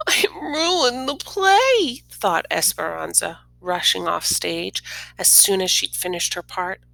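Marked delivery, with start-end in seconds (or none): 0.1-2.0 high, louder, slow wail; upset girl. A narrator's reading. Girl despairs after forgetting her lines, high slow wail. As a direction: let the dismay spill out.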